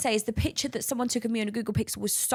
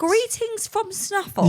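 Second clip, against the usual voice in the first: incredulous; 8 semitones higher, much slower than usual. Real, not acted. Incredulous repeat of quote.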